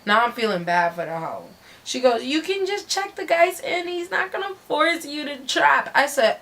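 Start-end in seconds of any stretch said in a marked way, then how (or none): 2.2-5.9 high, whiny coaxing; mock pleading whine; imitating pleading friend. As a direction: mimic her bargaining in a high voice. Imitates sobbing friend bargaining.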